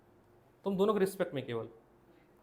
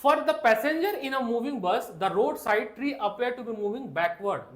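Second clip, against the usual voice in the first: reading the NCERT line aloud word by word; drawn-out dictation to the class; slow textbook reading; 4 semitones higher, 3 dB louder, much slower than usual. Shown as a demonstration. Teacher reading the textbook line slowly to students.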